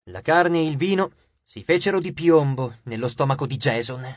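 ordinary delivery, nothing marked

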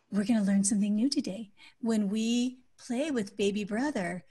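calm parent introducing a rule; really calm voice. Shown as a demonstration. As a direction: gently coach a small child, keeping it soft. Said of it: "say it in a really calm voice".

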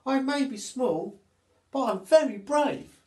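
high, loud, proud monkey voice; little monkey boasting she's brave. Acted. Monkey declares her bravery.

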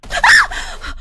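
sudden shriek of fright; startled scream. Real, not acted. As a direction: scream from a jump scare. Jump scare in horror game.

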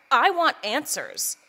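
loud, slow demand. Acted. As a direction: insist loudly on answers. Demanding answers about murder.

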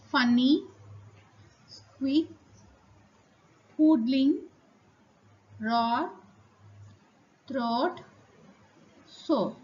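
each word alone, a pause after it, slight rise; dictation reading. Shown as a demonstration. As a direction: read out spelling words for the class to write down. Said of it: "let me read out, uh, dictation words".

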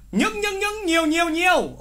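những, những, những sung high, then nhiều, nhiều, nhiều; teacher drilling the words những and nhiều; repeated emphasis. Shown as a demonstration. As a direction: chant the key words high and light. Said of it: "Để ý này, những nhá".

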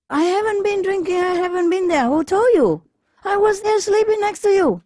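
very high, squeaky denial; a drunk husband denying everything to his wife; protesting husband. Acted. Husband denies, acted.

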